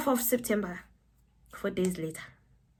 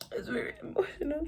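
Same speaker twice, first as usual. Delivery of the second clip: high, soft whisper, all choked up; mock emotional; 8 semitones higher, 8 dB quieter, faster than usual. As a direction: whisper it as if overcome, hammed up. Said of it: "it's very emotional for me".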